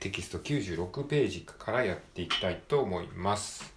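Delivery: ordinary delivery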